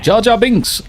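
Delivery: high-pitched burst of recognition; sudden high, excited name call; excited exclamation. Retold or imitated. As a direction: blurt the name out high and gleeful. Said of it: "I'm like".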